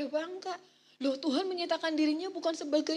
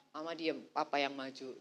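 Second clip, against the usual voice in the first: lower, softer, firm take-charge line; protective father; 12 semitones lower, 5 dB quieter than usual. Retold or imitated. Husband stepping in when neighbour is angry, low firm voice. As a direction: hold her back, low and firm. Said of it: "tetangga marah-marah".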